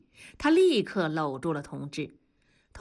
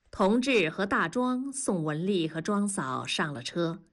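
ordinary delivery

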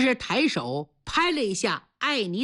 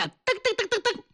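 rapid high-pitched patter of pounding feet; storyteller's running sound effect; imitated running footsteps; 10 semitones higher, faster than usual. A narrator's reading. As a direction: rattle off quick staccato footfalls.

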